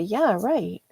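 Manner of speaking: "Yeah, right" with swooping, sing-song pitch; different attitude. Shown as a demonstration. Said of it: "Or they could say".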